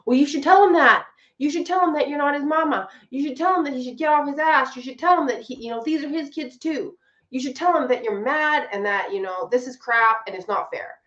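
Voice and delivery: a wife's friends piling on with high, bossy advice; mock bossy advice. Mimicking people who give pushy advice.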